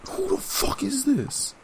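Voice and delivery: slow, high, startled yell; mock outraged neighbor; startled old man.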